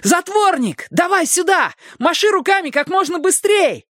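high, full-voiced calling from afar; urgent shout. A narrator's reading. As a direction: shout down urgently to your friend. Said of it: "закричал он". (срочный высокий крик другу)

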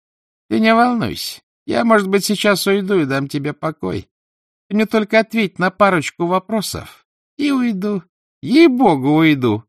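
high-pitched, mock-soothing guest; uninvited guest. A narrator's reading. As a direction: coax in a high, put-on voice. Uninvited guest taunts sick woman.

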